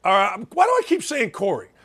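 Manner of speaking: usual speaking voice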